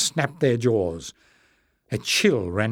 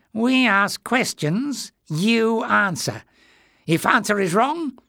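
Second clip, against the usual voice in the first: sly creature; 9 semitones higher than usual. A narrator's reading. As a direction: toy with the captive king. Greyness explains cruel game.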